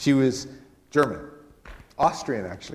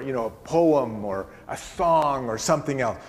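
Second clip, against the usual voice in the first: ordinary delivery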